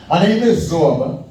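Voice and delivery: mock grand self-introduction; grand announcement. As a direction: announce yourself like a showman, slow and big. Announcing his name grandly.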